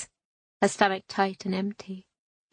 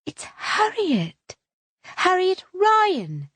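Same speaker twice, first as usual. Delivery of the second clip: high, slow, drawn-out introduction; gushing Harriet; 11 semitones higher, 3 dB louder, much slower than usual. A narrator's reading. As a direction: savour your own name grandly. Harriet announces her own name.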